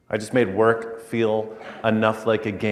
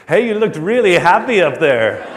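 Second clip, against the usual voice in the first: lightly put-on Italian accent, higher and louder; teasing line in a light Italian accent; Massimo Bottura; 9 semitones higher, 12 dB louder than usual. Famous Italian chef teasing the chef about his face, light Italian accent. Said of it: "in his very thick Italian accent".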